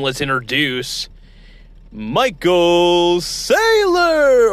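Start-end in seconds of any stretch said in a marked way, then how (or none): 1.9-4.5 high, very slow stretched name; drawn-out announcer call. Shown as a demonstration. Host introducing his guest in a drawn-out high call. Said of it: "or as I always say".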